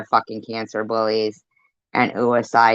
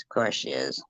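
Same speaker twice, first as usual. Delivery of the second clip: much lower and softer; quiet sarcastic; 11 semitones lower, 12 dB quieter than usual. Real, not acted. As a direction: drop it to a low aside. Sarcastic repeat, dropped low.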